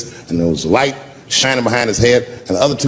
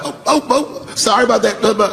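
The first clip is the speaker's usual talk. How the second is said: high, fawning apology, much higher; fawning police apology; 9 semitones higher than usual. Retold or imitated. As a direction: suck up fast to the man you just tackled.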